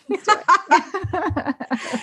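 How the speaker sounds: slow, high, laughing words. Real, not acted. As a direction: let the laugh carry it. Laughing agreement to blame him.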